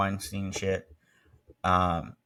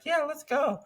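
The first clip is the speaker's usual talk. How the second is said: eager friends. Retold or imitated. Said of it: "the rest of the friend group was like".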